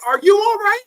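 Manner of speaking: high, loud worried call; gym teacher calling. Retold or imitated. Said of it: "the gym teacher's like".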